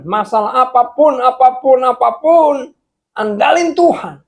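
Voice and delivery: loud, high exhortation building in intensity; full-force preaching. Real, not acted. Preacher urging the congregation to rely on God, impassioned.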